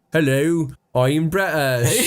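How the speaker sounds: put-on broad West Country British accent; American overdoing a British accent; overdone British accent. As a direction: lay the British accent on too thick, slowly. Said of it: "that's actually too British".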